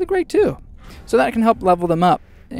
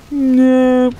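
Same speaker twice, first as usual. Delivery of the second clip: reluctant orc; 3 semitones higher, slower than usual. Retold or imitated. Orcs' reply in put-on voice.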